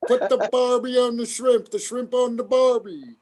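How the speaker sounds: high, hammed-up Australian accent. Friend asked for Australian, high mock Australian accent. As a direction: do a badly faked Aussie, pitched high. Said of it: "do an Australian accent".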